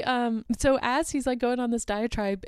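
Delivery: ordinary delivery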